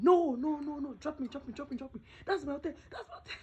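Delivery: high, loud panicked yell; shouting. Retold or imitated. Passenger shouts at tricycle driver to stop, panicked yell. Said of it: "I shouted".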